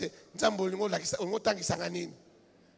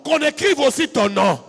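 loud, high sermon climax over music; fiery preaching; 8 semitones higher, 16 dB louder than usual. Acted. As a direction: preach it full force to the crowd, half singing. Preaching at full force.

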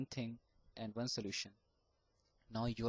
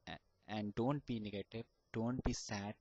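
ordinary delivery

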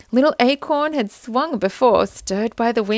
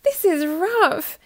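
high, slow little complaint; bumped around, say it slowly; a touch of grumbling acorn; 9 semitones higher, slower than usual. A narrator's reading. Acorn bouncing in the truck, high and slow. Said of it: "he said".

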